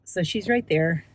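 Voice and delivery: ordinary delivery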